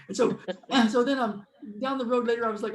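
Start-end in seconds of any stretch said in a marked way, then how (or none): none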